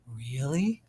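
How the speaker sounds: usual speaking voice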